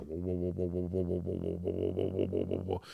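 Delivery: low, soft pulsing ray noise; hushed, droning sci-fi beam sound; imitated alien ray. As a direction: repeat a low "whoa" like a tractor beam. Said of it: "She's got the fucking rays up".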